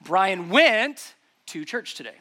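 "went" drawn out loud, the rest falling away; stress on went. Shown as a demonstration. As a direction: lean long and hard on "went". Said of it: "emphasize the different words differently".

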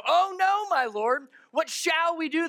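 high, hammed-up cry of alarm; mock dismay. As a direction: play the servant's panic in a high voice. Re-reads servant's line, more dramatically.

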